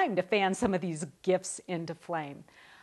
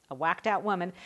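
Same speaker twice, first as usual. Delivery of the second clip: ordinary delivery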